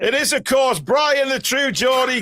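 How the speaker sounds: announcer. Acted. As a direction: hype up the guest's arrival. Announces the guest's entrance.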